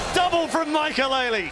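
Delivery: high, loud play call; excited call. Real, not acted. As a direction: ride the hype of the kill. Caster calls big play.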